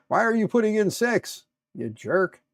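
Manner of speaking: loud, high-pitched yell at the screen; annoyed yell. Real, not acted. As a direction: snap at the software loudly. Software keeps entering wrong number.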